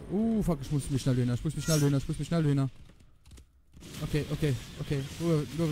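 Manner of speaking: normal talking voice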